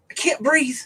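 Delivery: high, imitated panic; petrified buddy. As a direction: act out a terrified friend short of air. Retelling petrified buddy gasping for help.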